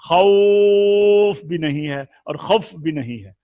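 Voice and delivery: wrong pronunciation demo; wrong takes shown. Shown as a demonstration. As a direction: demonstrate the wrong vowels in खौफ. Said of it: "ये देखिए खौफ".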